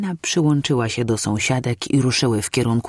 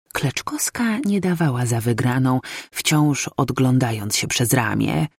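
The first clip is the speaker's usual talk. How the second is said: ordinary delivery, nothing marked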